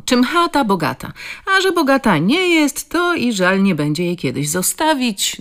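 jokingly. A narrator's reading. Inviting the stranger in, a joking, teasing tone. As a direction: play the proverb for a laugh. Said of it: "dodała żartobliwie".

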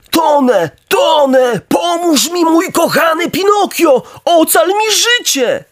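high, drawn-out wail for rescue; heartrending cries. A narrator's reading. Drowning bulldog begs Pinokio to save his life, desperate high cries. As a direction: plead desperately at a very high pitch. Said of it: "te rozdzierające wołania".

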